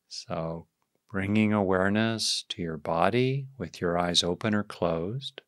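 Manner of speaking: calm, unhurried meditation voice; guided meditation. Real, not acted. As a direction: ease listeners into awareness, leaving space after each phrase.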